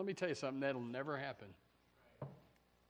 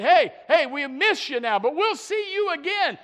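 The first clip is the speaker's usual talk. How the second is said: loud and high, warm call to the departed; hopeful mourner; 13 semitones higher, 15 dB louder than usual. Retold or imitated. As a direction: call out to a loved one with hope. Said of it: "It's a sorrow that".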